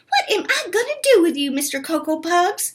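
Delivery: high, fast mock scolding; fast high fond scold. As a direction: tease him for the mess. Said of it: "she scolded".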